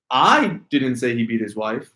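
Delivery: "I" held and stressed, rest of the sentence falls away. Shown as a demonstration. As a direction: lean on "I" at the start. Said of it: "if you inflect the word".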